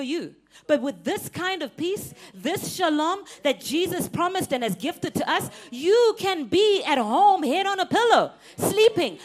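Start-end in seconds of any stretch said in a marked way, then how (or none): 5.7-9.2 loud, slow, high preaching refrain. Acted. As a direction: stretch each phrase out over the congregation.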